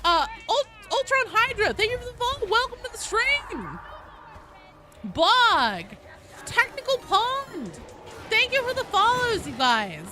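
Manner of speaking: put-on Valley Girl voice. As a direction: gush the welcomes like a Valley Girl. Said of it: "it's the Valley Girl voice".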